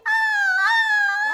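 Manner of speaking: long, very high, loud scream; girl's scream. Acted. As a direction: shriek and hold it. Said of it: "ran around the room screaming".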